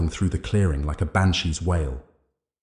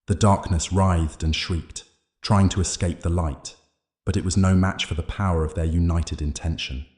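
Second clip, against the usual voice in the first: usual speaking voice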